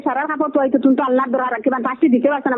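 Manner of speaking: normal talking voice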